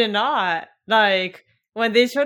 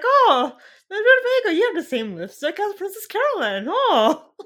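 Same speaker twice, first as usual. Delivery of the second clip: Mister Peanut Butter; 10 semitones higher, 7 dB louder than usual. Retold or imitated. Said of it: "it's like".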